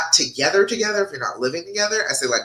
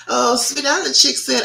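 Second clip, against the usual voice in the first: usual speaking voice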